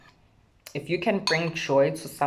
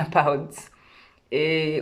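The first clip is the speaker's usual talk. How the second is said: ordinary talk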